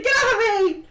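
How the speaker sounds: high, loud cry to be freed; struggling shout. Acted. Brother tackled by his sister, struggling shout. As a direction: yell while being tackled.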